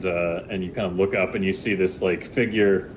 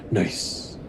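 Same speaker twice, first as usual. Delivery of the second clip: whispered nice; 4 dB quieter than usual. Acted. GM reacting to secret roll.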